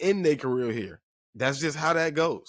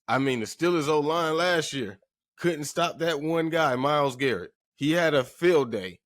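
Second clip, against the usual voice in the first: ordinary delivery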